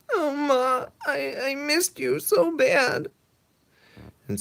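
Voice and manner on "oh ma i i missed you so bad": high, slow, crying voice; teary Jimmy. Acted. Jimmy tells his mother he missed her, high and teary. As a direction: sob it out with relief.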